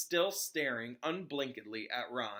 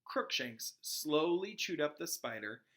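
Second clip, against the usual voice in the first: ordinary delivery, nothing marked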